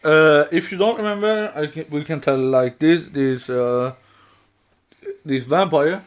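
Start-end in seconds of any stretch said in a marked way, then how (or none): none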